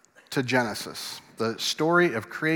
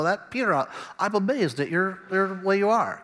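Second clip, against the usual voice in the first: high-pitched comic character voice; put-on Nazareth voice; 6 semitones higher than usual. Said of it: "that's how he sounds".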